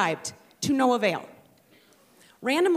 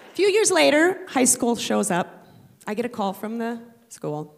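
normal talking voice

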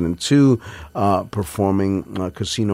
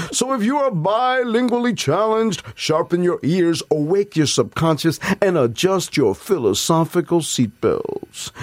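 higher, bright commercial pitch voice; ad announcer; 10 semitones higher than usual. Acted. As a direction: sell it like a TV ad. Mock Tostitos commercial pitch.

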